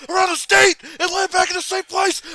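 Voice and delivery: high, loud mock-raving rant; screaming Bernie. Imitating Bernie raving in a straitjacket, hysterical screaming. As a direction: scream it like someone losing it. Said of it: "Bernie's in a straitjacket screaming".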